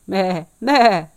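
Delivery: two loud goat bleats; imitated goat's meh. Goat bleats after answering.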